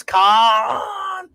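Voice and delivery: hammed-up high shout of the name; imitating a movie yell; mock Khan yell. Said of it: "when Spock Boy yells".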